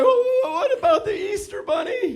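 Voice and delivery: very high wailing child impression; exaggerated high-pitched tearful whine; mock crying. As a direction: wail it like a devastated little girl, hammed up. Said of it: "and she's crying".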